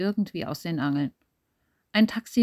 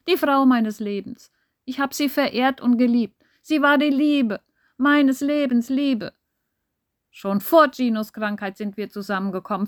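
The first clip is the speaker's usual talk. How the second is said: furious outburst; 4 semitones higher, 4 dB louder than usual. Acted. Massimo's angry confession.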